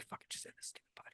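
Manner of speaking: fast, hushed grumble under the breath; muttered thought. Acted. Co-host's secret thought about the podcast, muttered under the breath. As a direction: whisper it like a fed-up inner thought. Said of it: "Robert would be like".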